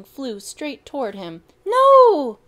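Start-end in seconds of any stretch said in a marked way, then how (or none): 1.7-2.4 very high, very loud cry of fear; terrified shout. Acted. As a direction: scream as the bee lands. Bee flying at Bronco.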